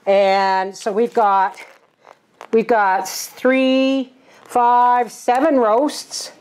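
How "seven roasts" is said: ordinary delivery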